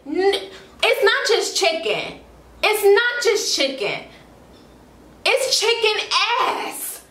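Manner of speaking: high, drawn-out exasperated protest; mock indignant correction. Replaying her complaint about the unnamed dish, high mock indignation. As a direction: stretch out the correction in hammed-up disbelief. Confronting the seller in retelling.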